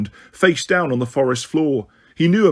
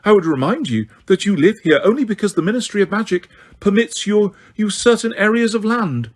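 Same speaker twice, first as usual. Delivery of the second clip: indignant; 7 semitones higher than usual. A narrator's reading. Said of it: "shaking now ... with indignation".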